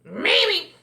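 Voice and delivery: high, loud duck. A narrator's reading. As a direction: answer as an excitable cartoon duck. Duck answers Franklin in character.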